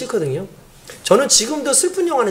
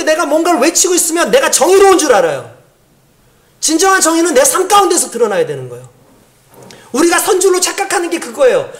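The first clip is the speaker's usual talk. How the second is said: louder, higher sermon drive; forceful preaching; 5 semitones higher, 3 dB louder than usual. Acted. Preacher urging the congregation about true justice, forceful. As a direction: press the point home to the congregation.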